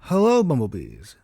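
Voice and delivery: plain reading voice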